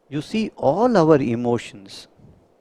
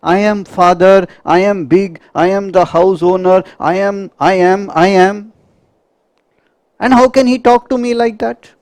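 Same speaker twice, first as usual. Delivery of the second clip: puffed-up angry father; 5 semitones higher, 6 dB louder than usual. Acted. Same father's wounded ego.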